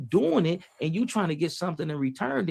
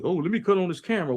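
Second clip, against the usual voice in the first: quick, slightly raised mock clout-chaser; mock show-off; 3 semitones higher, 4 dB louder, faster than usual. Mocking a man who films his good deeds, quick and a touch louder. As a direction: tease the guy who films his charity. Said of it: "do it to be seen".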